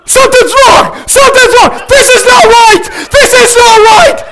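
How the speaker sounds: high, loud panicked cry; panicked yelling. Retold or imitated. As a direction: yell like something's broken on the ride. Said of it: "Losing his mind".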